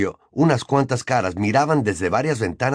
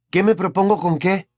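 higher-voiced boy answering back coolly; cool, questioning comeback in a higher voice; cold retort; 8 semitones higher than usual. A narrator's reading. Nephew throwing his uncle's question back, coldly. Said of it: "replicó fríamente Harry".

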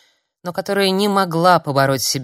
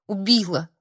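usual speaking voice

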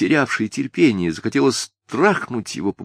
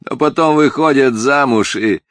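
loud sardonic jab, slowing at the end; biting, emphatic retort; caustic; 4 semitones higher, 6 dB louder than usual. A narrator's reading. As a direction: needle with knowing scepticism. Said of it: "язвительно заметил мальчик".

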